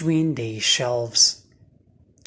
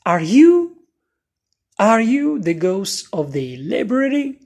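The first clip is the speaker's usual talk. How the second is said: nervous; 7 semitones higher, 5 dB louder than usual. A narrator's reading. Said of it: "Oliver nodded nervously".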